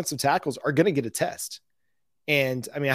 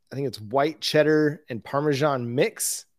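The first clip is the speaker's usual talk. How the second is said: ordinary talk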